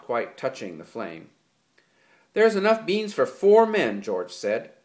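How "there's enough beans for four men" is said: pointed. A narrator's reading. George sharp with Lenny.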